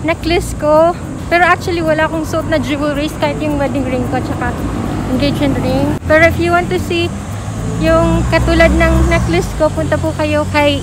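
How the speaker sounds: muffled masked speech; talking behind surgical mask; through a face mask. Real, not acted. Seen 0: Surgical mask covers her mouth and nose while she speaks.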